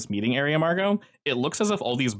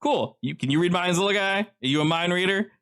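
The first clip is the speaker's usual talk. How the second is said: high teasing questions; [name] teasing; 8 semitones higher than usual. Acted. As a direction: poke fun at the little guy.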